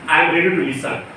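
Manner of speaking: ordinary delivery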